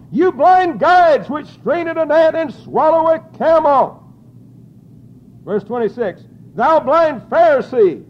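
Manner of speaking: very loud, high preaching shout; full-force preaching. Acted. Preacher thundering Christ's words at the Pharisees, loud preaching. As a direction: blast the rebuke at the congregation.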